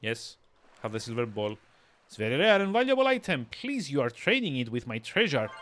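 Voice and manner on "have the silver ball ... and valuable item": ordinary talk, nothing marked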